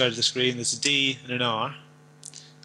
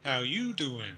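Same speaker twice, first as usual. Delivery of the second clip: softer caricature New York greeting; New York accent; 7 dB quieter, slower than usual. Shown as a demonstration. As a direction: play a New Yorker sidling up, softly. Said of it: "all New Yorkers speak like that".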